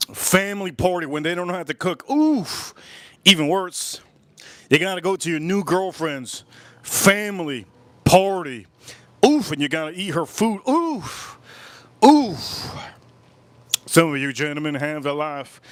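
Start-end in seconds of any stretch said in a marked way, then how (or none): 2.1-2.7 high disgusted groan; high pained oof. Real, not acted. Disgusted reaction. 10.7-12.7 high disgusted groans; high pained oof. Real, not acted. Disgusted reaction.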